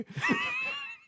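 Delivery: high screaming laugh; shrieking laugh. Real, not acted. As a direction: burst out in a shrill laugh. Bursts out laughing.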